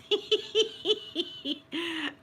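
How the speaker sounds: high burst of laughter. Real, not acted. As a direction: laugh out at the memory. Laughing at the memory.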